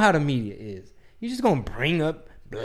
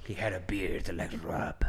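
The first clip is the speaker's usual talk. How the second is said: unvoiced whisper tossed in as a joke; whispered aside; 7 semitones lower, 12 dB quieter than usual. Real, not acted. Host whispering a joke about the guest's beard, unvoiced aside. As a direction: whisper the punchline on the side.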